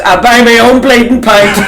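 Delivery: hammed-up grumpy northern punter refusing a pint; gruff club man. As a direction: snap it back like a put-out punter. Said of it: "he says".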